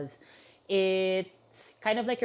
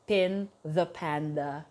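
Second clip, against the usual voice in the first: "Pin the Panda" said slowly, word by word; separated words; 4 semitones lower, slower than usual. Shown as a demonstration. Explaining a supposed play on words to viewers, slowly separated. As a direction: draw out "Pin the Panda", lower and slower.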